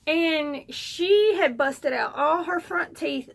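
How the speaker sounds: ordinary delivery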